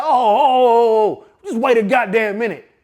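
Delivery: outraged grandfather. Retold or imitated. Grandpa hearing grandson is threatened, building outrage. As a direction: work up into fury at the news.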